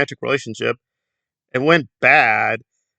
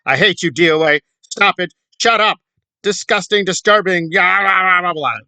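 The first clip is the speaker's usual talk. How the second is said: mock tantrum; 5 semitones higher than usual. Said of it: "She's going all out".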